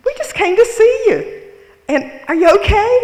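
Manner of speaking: very high, loud, squeaky sugary tone; Minnie Mouse voice. Retold or imitated. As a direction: overdo the cartoon mouse sweetness. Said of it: "in her Minnie Mouse voice".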